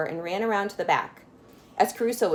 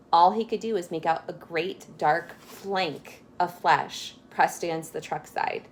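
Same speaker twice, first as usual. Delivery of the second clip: ordinary delivery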